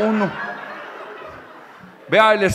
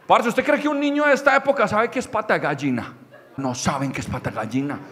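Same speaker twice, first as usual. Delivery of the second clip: normal talking voice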